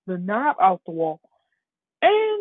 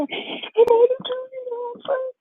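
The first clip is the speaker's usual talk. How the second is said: tearful squeak. Acted. Deku overcome at being chosen as successor, tearful squeak.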